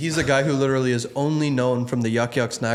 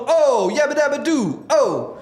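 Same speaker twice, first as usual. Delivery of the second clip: put-on high, slow Italian-accented yabba dabba doo; an Italian trying Fred Flintstone, badly; hammed-up Italian Flintstone; Italian doing Fred Flintstone; 13 semitones higher, 4 dB louder, slower than usual. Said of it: "So here, I'll do it".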